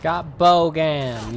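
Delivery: triumphant. Real, not acted. Kills enemy Bogan.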